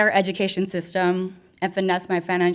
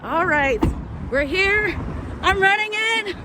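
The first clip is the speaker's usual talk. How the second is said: excited; 10 semitones higher, slower than usual. Real, not acted. Rushing in, excited.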